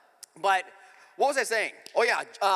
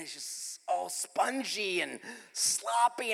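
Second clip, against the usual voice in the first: drawn-out, queasy relish of each word; slow comic disgust; disgusted description; 4 dB quieter, much slower than usual. Acted. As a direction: stretch the words slowly in revulsion. Describing revolting childhood pancake.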